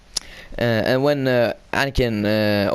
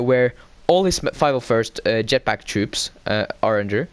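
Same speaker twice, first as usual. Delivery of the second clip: usual speaking voice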